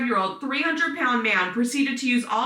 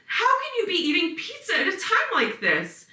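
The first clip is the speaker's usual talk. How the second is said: high, indignant wail of disbelief; tearful girlfriend crying out at him over pizza; dramatic outrage; 10 semitones higher than usual. Retold or imitated. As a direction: replay the hurt outrage, overdone on purpose. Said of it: "Which may be a little dramatic".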